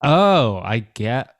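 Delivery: usual speaking voice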